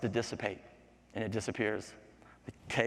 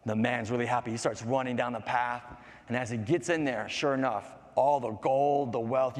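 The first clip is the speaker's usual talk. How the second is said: ordinary delivery, nothing marked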